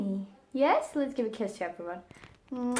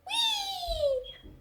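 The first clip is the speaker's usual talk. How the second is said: wee sound. Shown as a demonstration. Owner prompting her parrot to squeak, very high wee. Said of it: "he doesn't want to make any sound".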